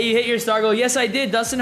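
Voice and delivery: usual speaking voice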